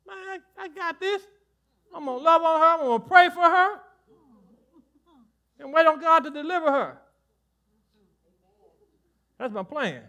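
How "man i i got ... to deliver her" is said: lightly raised, confident inner voice, a bit higher; inner resolve voice. Retold or imitated. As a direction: voice your own calm resolve to yourself. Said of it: "Oh, in my mind".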